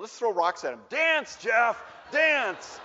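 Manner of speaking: loud mock-cruel commands; gleeful shouting. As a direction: shout it like a bully egging on a crowd. Imagined dad joins the tormentors.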